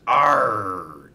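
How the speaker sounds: loud pirate ghost arr; scary ghost growl. A narrator's reading. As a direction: growl it to frighten. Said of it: "came a scary voice".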